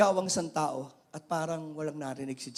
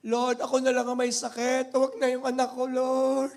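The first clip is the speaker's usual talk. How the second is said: mild pleading mother; 4 semitones higher, 6 dB louder than usual. Acted. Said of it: "Yung mga nanay na sinasabi".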